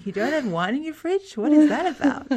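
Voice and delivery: imitating a shocked friend, rising high; hammed-up horror at an empty fridge; mock shocked disbelief. Imagining the American friend's shock at no wine, mock aghast. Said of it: "I could just see her thinking".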